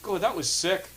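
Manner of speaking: high squeaky disgust; mock Angus voice. Angus voice mocking the bad British accent. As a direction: sneer it in a cartoon squeak.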